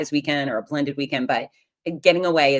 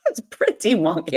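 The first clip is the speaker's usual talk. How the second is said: amused at herself; 3 semitones higher, 3 dB louder than usual. Real, not acted. Patient admitting Xanax made her wonky, laughing. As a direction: repeat it with a real laugh bubbling out.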